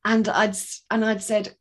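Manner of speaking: ordinary delivery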